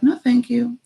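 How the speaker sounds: light, high-pitched easy decline; acted-out chill, airy and high; chill refusal. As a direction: turn it down breezily, pitched well up. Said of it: "and be chill".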